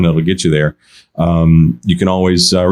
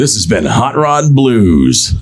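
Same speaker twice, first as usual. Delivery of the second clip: put-on old-timey radio voice; much slower than usual. Shown as a demonstration. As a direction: drag it out like an old radio host. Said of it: "you do a really good old-timey voice".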